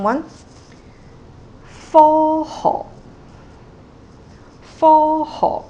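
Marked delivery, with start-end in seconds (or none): 1.9-5.6 loud, deliberate step down in "科學"; tone one, tone six. Shown as a demonstration. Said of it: "tone one plus tone six".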